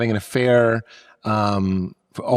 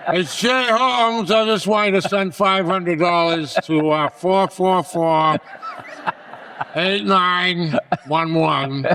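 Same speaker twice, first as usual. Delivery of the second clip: slow, higher, lordly dad voice; dad on the phone; 6 semitones higher, slower than usual. Retold or imitated. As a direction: talk down to the bank like the boss. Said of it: "I could call my father's bank".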